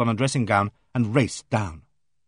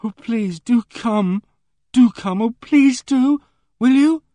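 high, loud, desperate begging; a frightened girl begs him, high and loud; frightened pleading; 12 semitones higher, 7 dB louder, slower than usual. Acted. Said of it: "indescribably forlorn and frightened".

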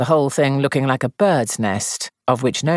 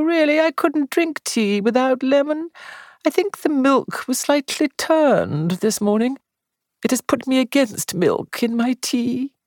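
put-on high, feeble, fretful old lady; weak invalid voice; 9 semitones higher than usual. A narrator's reading. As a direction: sigh every wish like a martyr. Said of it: "a thin, weak voice".

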